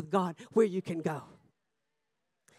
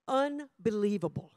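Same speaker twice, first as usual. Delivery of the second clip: usual speaking voice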